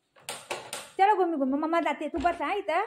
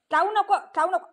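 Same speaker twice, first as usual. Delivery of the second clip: higher, louder scold; sharp scolding; 8 semitones higher, 5 dB louder, faster than usual. Real, not acted. Mother scolds the pet for biting, sharp and loud. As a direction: stop the biting right now.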